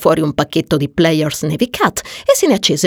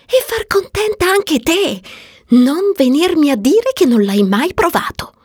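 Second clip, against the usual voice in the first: high-pitched insistent friend; high, eager persuasion; earnest Marion; 10 semitones higher than usual. Acted. Marion's part in the dialogue.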